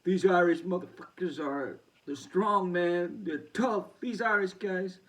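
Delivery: loud impression of the opponent praising the Irish; imitating Berlanga. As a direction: mimic the boxer's praise, loud and high. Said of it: "he was saying".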